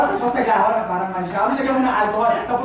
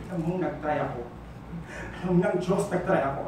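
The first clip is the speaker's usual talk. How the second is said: low, quiet sincerity; hurt insistence; 6 semitones lower, 6 dB quieter, faster than usual. Acted. Jilted partner insisting they tried, low and hurt. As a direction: drop from anger into quiet hurt.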